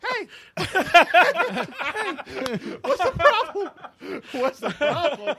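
high loud squeaky voice; mock voice changer. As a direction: squeal it through a laugh.

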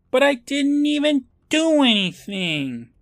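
put-on high squeaky pleading kid voice; whiny high protest. [name] protesting to the judge, high whiny voice.

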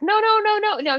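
frantic high "no, no, no"; panicked high-pitched pleading no's; retold screaming.

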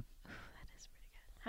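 hushed unvoiced whisper. Real, not acted. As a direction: breathe it without voice. Whispered aside.

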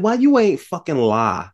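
high-pitched incredulous question; exasperated scolding. Retold or imitated. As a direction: berate a foolish film character. Berating the film character.

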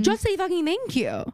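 high, exasperated demand; fed up, demanding basic manners; heated. Real, not acted. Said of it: "I'm getting heated".

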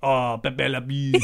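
slow mock French; drawn-out cartoon Frenchman; French accent. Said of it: "your French listenership".

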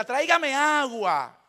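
drawn-out order from the sofa; bossy father. Retold or imitated. Father on the sofa orders mother to bring water, slow bossy command.

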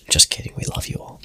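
whispered sign-off; whispering. Real, not acted. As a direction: breathe it out as a whisper.

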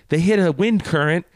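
loud, high retold report; urgent calling out. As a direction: imitate someone calling out alarming news. Acted shout reporting the missing buddy.

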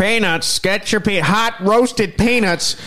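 high, slow vendor call; stadium vendor hawking. Pretend. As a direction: hawk peanuts up the aisles. Mimicking arena vendors.

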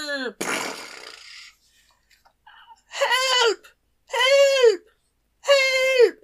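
high, loud cries for help; desperate cry for help. Acted. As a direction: shriek for help from under a tree. Elf trapped under tree.

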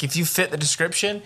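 normal talking voice

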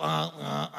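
low, soft little grunts; small quiet honks back; imitated child's reply. Little child answering the nagging parent, soft low grunts. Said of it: "y el hijito".

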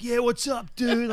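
hammed-up, high-pitched dude greeting; high-energy producer. Producer greets a stranger on the phone, hyped-up impression. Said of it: "energy through the roof".

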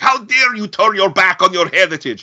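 high, loud mock-outrage in an accent; hammed-up indignant scolding, Ukrainian accent; imitated outraged Ukrainian. Refugee berating the actor for denying his roots, mock-outraged. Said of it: "got into a fight with him".

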